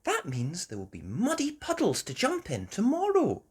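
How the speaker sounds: a hint of a smiling mother; Mummy Pig smiling. A narrator's reading. Mummy Pig to Peppa at bedtime, lightly voiced smiling mum. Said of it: "Mummy Pig smiled".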